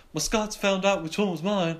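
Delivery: high put-on child voice; Brian. Boy telling classmate about the drawing, high child imitation. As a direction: report it in an imitated kid's voice. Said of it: "Brian comes over and he's like".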